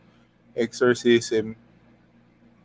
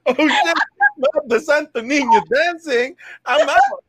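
terrified demon; 12 semitones higher than usual. Pretend. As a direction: shriek like a demon fleeing. Plays the terrified fleeing demon.

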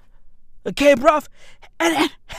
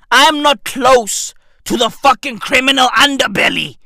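usual speaking voice

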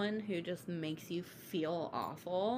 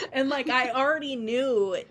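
laughing; 7 semitones higher, 8 dB louder than usual. Real, not acted. Laughing at her own mess.

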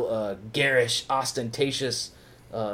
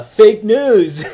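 mock Trump; 9 semitones higher, 15 dB louder than usual. Trump catchphrase mocked with laugh.

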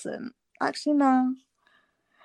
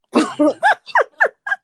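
loud high laugh; 11 dB louder than usual. Real, not acted. Laughs at own joke.

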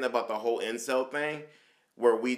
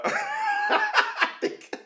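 laughing; much slower than usual. Real, not acted. Laughs before speaking.

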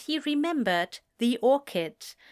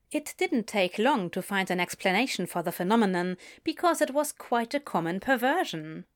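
usual speaking voice

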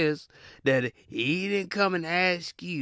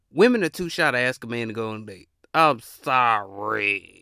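ordinary delivery

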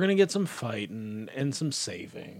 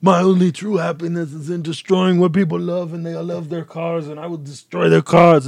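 very loud, higher-pitched mock action-star accent; bad celebrity impression falling apart into laughter; Arnold; 4 semitones higher, 10 dB louder than usual. Car-hating villain boasting of destruction, bad Arnold impression. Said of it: "this is a really bad impression".

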